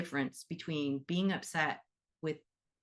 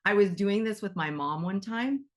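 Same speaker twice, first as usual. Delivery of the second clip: ordinary delivery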